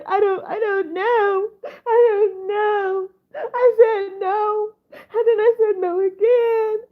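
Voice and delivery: tearful, drawn-out mock sob; slow, weepy retelling of her crying; imitating crying. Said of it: "she's like".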